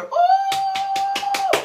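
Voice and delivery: sudden high preacher's whoop; high ecstatic whoop. Real, not acted. Preacher whooping to the congregation, high joyful cry. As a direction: cry out in joy.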